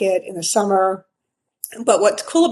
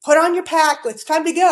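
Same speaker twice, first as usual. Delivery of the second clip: imagined husband; 7 semitones higher than usual. Pretend. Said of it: "I'm imagining this conversation".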